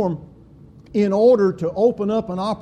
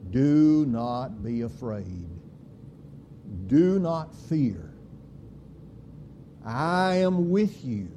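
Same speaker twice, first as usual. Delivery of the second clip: softly and slowly, lower, as a gentle promise; slow tender comfort; 4 semitones lower, 4 dB quieter, slower than usual. Acted. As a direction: lay the words down slowly to calm a frightened soul. Quoting God's comfort, slowly.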